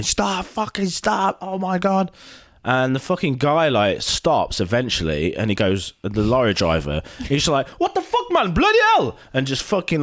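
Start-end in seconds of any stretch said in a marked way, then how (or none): 0.0-2.1 high, stretched mock scream without full volume; imitated screaming. Retelling onlookers at the motorway crash, mock screaming. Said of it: "screaming, beeping, going". 7.8-9.2 very high imitated yelling, louder at the end; freaking-out shout. Lorry driver to the other guy, mock freaked-out shouting. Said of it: "starts freaking out and, like, shouting".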